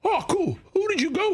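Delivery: high, innocent follow-up question; breezy, high-pitched act of curiosity; fake casual. Shown as a demonstration. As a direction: feign interest to bait a story. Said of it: "just totally smoothly".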